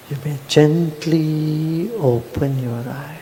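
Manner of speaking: ordinary delivery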